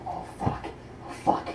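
unvoiced whispering swear; whispered panic. Panicking after killing him.

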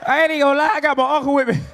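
usual speaking voice

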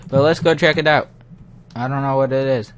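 loud eager lead-in to explore; excited curiosity. Real, not acted. Gamer spotting something strange, loud excited. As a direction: push forward with excitement.

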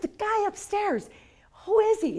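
excited high demand; giddy insistence. Retold or imitated. Pressing boss about the man.

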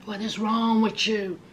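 hushed brotherly warning; whisper. Retold or imitated. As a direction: warn him under your breath. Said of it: "he said".

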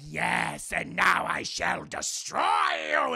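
slow, drawn-out villain declaration; evil villain. Retold or imitated. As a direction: gloat like a demon, stretching it out. Villain reveals himself.

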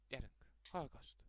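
soft, hushed, mock relaxing voice; mock soothing hush. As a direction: coax them to just listen, gently. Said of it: "Csak csukd be a szemed". (halk, tréfásan nyugtató suttogás)

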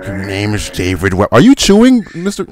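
Albert Finney voice, as if eating; talking like mouth full of food; Albert Finney impression. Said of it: "Finney always sounded like he was eating something all the time".

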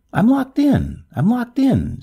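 high, restrained storybook scream. A narrator's reading. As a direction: cry it out in panic, pitched well up. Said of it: "screamed the hatless lady".

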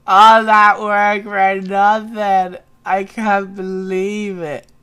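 slow, loud wail of despair; hammed-up lament. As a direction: drag it out in mock misery. Laments wasted work.

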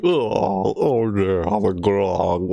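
put-on groaning drunk voice; imitated drunk. Streamer mimicking crewmates drinking too much grog, mock drunk voice. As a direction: play someone queasy from grog. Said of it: "they're drinking too much grog".

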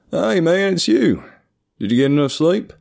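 high, loud, over-cheery country greeting; unnaturally joyous. A narrator's reading. Seatmate greeting a coworker after the flight, unnaturally joyous. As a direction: greet him with too much joy, in a country accent. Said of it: "asked in the tone that seemed unnaturally joyous".

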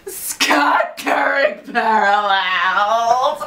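slow, stretched-out fan yell; drawn-out shout. Real, not acted. Fan announcing the next topic, drawn-out shout. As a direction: stretch every word into a holler.

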